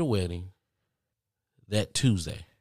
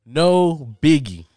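drawn-out, loud sarcastic no biggie; a slow, loud eye-roll of a line; 6 semitones higher, 10 dB louder, much slower than usual. Real, not acted. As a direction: say it dripping with sarcasm. Sarcastic aside about covid rules.

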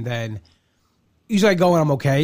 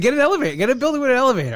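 much higher, louder, fast exasperated demand; exasperated retort; 10 semitones higher, 5 dB louder, much faster than usual. Retold or imitated. To the therapist about the stairs, high loud exasperated demand. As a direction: throw it back at her, fed up.

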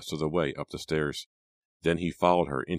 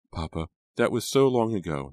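higher-pitched, pleading protest; crying out; 5 semitones higher, 4 dB louder than usual. A narrator's reading. Daughter protesting to her father, crying out. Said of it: "Nora cried".

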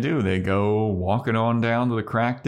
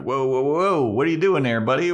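higher, louder alarmed Spike; mock-startled vampire; 6 semitones higher, 4 dB louder, faster than usual. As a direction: back off, nervous and quick.

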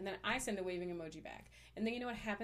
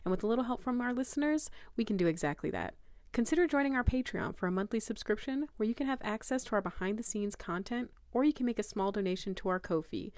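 ad read; 2 semitones higher, 8 dB louder than usual. Acted. Sponsor read in announcer style.

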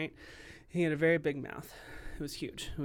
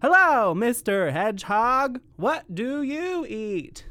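loud, slow, wide-mouthed frog greeting; big mouth frog; 7 semitones higher, 9 dB louder, slower than usual. Acted. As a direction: open wide and boom the hello.

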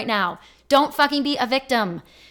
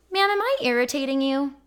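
slightly higher, measured confrontation, re-enacted; pointed polite question; 4 semitones higher than usual. Caller asking the irritated insurance agent, pointedly polite. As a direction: reenact a controlled, direct challenge. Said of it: "I said it exactly like that".

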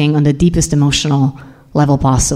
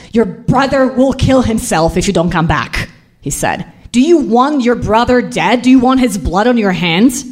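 raised pitch, pressing reproach; stern guilt-tripping; 5 semitones higher than usual. Retold or imitated. Father pressuring his daughter to return to Serbia, reproachful. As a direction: press the guilt home, voice climbing.

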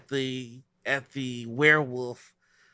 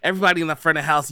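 usual speaking voice

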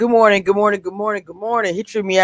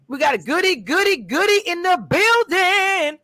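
hype announcement; 9 semitones higher than usual. Real, not acted. Hypes guest arrival.

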